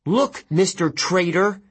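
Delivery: put-on high, angry girl's voice; slow, scornful confrontation of a traitor; indignant, pitched well up; growled. A narrator's reading. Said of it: "she growled".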